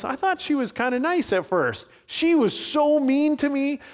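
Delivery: high, whining voice as Henry; complaining like a worn-out man; whiny complaint. Retold or imitated. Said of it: "And he goes".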